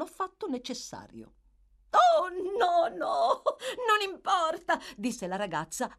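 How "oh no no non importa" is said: high, loud, weepy voice; tearful protest. A narrator's reading. The girl waves away the doctor, still tearfully wailing. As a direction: wail the refusal through tears.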